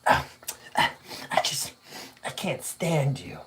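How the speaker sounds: breathy whispered jab. Real, not acted. Streamer whispering to himself on stream, under his breath.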